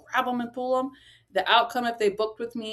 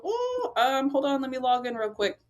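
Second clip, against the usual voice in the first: mock-flustered, caught off guard and stalling; a jumpy, high "Oh" then a scramble to log in; flustered and unprepared; 5 semitones higher than usual. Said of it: "than me going".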